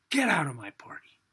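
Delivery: sharp hissed order to leave; hostile order. Acted. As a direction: throw him out of the party in a hiss. Rich man throwing out guest.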